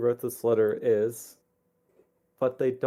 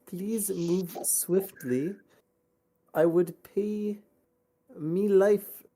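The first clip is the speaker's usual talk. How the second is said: slow, slightly raised letter voice; lightly voiced letter writer; 8 semitones higher, much slower than usual. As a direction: read it like a pleading parent. Reads letter in character voice.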